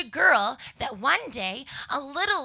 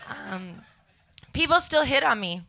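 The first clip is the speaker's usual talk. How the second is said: normal talking voice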